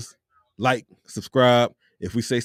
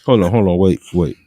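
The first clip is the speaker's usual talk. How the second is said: louder, cutting in to stop the flow; loud interruption; 5 semitones lower, 6 dB louder than usual. Real, not acted. Host cutting off an excited guest, loud interruption. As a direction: halt him mid-story, firmly.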